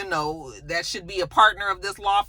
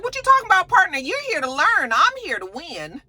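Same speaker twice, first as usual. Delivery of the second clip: incredulous scoff; 10 semitones higher than usual. Retold or imitated. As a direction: scoff at him, pitch shooting up. Jax scoffing at Thomas.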